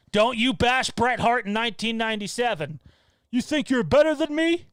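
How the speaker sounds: loud, high heel-wrestler snarl; wrestler promo. Acted. Fan shouts a Bret Hart heel promo at the camera. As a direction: bark it like a ring promo.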